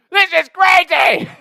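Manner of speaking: loud, high, slow shout; excited yelling. Retold or imitated. Tripping in a crowded bar, yelling at his friends. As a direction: yell it, laughing and amazed. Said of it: "I'm, like, yelling and shit".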